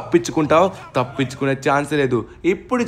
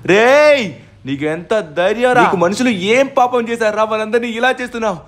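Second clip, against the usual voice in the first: angry challenge; 8 semitones higher, 4 dB louder than usual. Acted. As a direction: shout it up high, then keep it hot and accusing. Confronting the villain boss. (కోపంగా అరుస్తూ నిలదీయడం)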